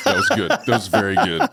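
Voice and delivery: can't hold back the laugh; host cracking up at the guest's joke, laughing. Real, not acted.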